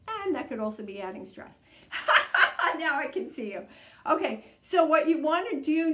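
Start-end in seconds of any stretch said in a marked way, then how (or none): 1.9-2.7 sudden high loud laugh; burst of laughter. Real, not acted. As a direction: break into a real laugh at yourself. Laughs at own distraction.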